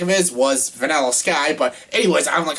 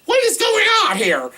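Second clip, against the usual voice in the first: mock bafflement in a much higher squeaky voice; baffled squeak; 4 dB louder than usual. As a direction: squeak out your confusion. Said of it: "I'm like".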